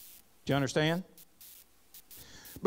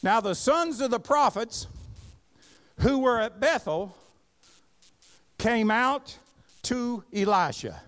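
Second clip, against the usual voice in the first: raised, ringing pulpit reading of scripture; 7 semitones higher, 4 dB louder than usual. Acted. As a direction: read the verse out with preaching force. Reading scripture aloud in sermon.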